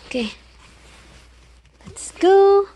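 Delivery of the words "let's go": high bright call to the dog; excited call. Real, not acted. Calling dog out the door.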